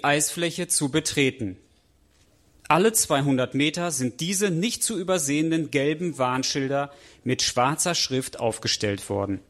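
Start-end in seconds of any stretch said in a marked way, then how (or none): none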